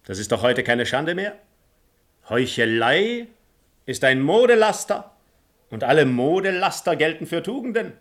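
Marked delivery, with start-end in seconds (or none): 2.3-7.9 laute Verkündigung. Acted. Don Juan preist die Heuchelei.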